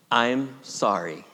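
low, slow and sincere; slow humble apology; modelling a prayer. Shown as a demonstration. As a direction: say it slowly, as a heartfelt confession. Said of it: "would you repeat those words after me".

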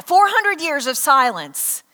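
usual speaking voice

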